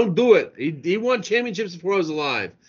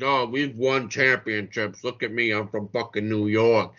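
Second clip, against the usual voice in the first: lower, lightly voiced swaggering New York fan; mock boastful Yankees fan; 9 semitones lower, 4 dB quieter than usual. Host mocking boastful New York fans, lightly put-on swagger. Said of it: "people that act like they've won twenty-something championships".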